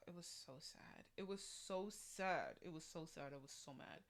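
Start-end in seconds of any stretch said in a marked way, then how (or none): 0.1-1.0 sighed, whispered regret; sigh, then let it out unvoiced; sad whisper. Real, not acted. Fan recalling Mirage's exit, sad whisper.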